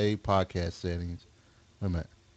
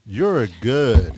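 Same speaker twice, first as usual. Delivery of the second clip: louder, slow drawn-out [name] line; imitating [name]; 7 dB louder, slower than usual. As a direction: lean into [name]'s catchphrase. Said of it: "You sound like [name]".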